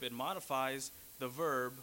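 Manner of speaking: ordinary delivery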